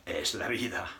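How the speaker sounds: "es la vida" in Spanish sounds; Spanish phrase. Shown as a demonstration. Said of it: "as they say in Spanish".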